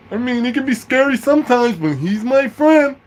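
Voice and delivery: high put-on voice, last words drawn out; special ed friend. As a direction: defend your friend in a mock high wail. Voicing friend answering sergeant.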